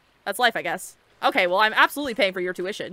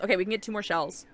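ordinary talk, nothing marked

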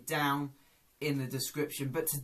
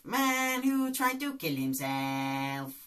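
Doctor Bob impression; slower than usual. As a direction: repeat it slowly in character. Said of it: "Lee Nelson's character, Doctor Bob".

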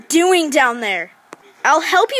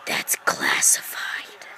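unvoiced secretive whisper; mysterious rescuer whispers a command; 8 dB quieter, much slower than usual. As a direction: breathe it like a shadowy hero.

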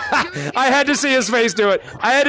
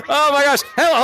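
ordinary talk, nothing marked

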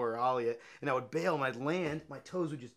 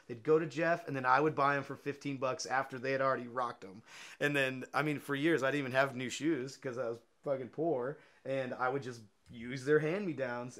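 ordinary delivery

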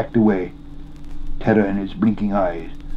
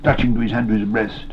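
usual speaking voice

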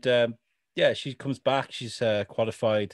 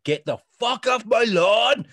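high, loud, slow "get off my lawn" rant; mock angry yell; 10 semitones higher, 6 dB louder than usual. Fan mimicking furious Wanda yelling at neighbours, loud and high. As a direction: bark it like a cranky homeowner. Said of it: "She's just like".

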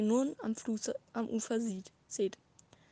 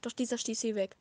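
usual speaking voice

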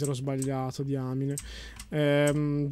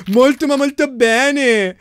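sarcastic emphasis; 5 semitones higher, 5 dB louder, much slower than usual. Real, not acted. Mocking teammate's failed defence.

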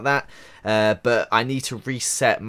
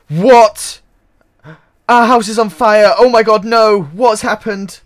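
much higher and louder alarmed yelling; panicked shout; 10 semitones higher, 13 dB louder, slower than usual. Real, not acted. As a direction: cry out at the burning house. Sees own house on fire.

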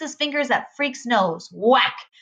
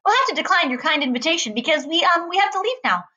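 high, nervous polite refusal; chattery high; 7 semitones higher than usual. A narrator's reading. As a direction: decline politely, pitched up high. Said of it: "his voice is chattery high".

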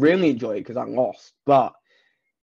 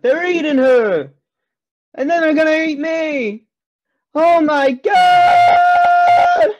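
hammed-up panic building to a huge high shriek; mock horror scream; 13 semitones higher, 6 dB louder, slower than usual. Retelling Troll Two, mocking the victim's terrified scream. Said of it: "Apologize to listeners there for, uh, for that little bit".

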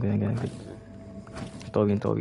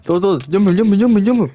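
loud, higher urgent yells; excited shouting; 6 semitones higher, 7 dB louder than usual. Real, not acted. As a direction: yell at the team to jump. Urging team to jump during fight.

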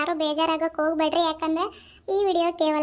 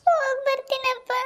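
high-pitched, crying daughter's goodbye; weeping as you take leave of father; tearful farewell; 9 semitones higher than usual. Acted. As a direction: say goodbye through tears, voice lifted high. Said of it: "ಅಳ್ಬಾರ್ದು ನಡಿ".

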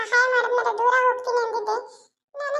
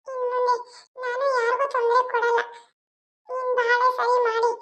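ordinary delivery

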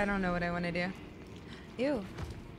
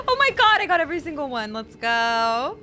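loud high victory shout; triumphant cheer; 5 semitones higher, 9 dB louder than usual. Real, not acted. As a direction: cheer the win at full volume. Wipes out all the enemies.

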